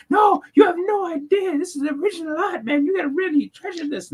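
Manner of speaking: very high Muppet-like voice, opening on a shout; excited [name] impression. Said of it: "infringing on K-pop's Muppet voice".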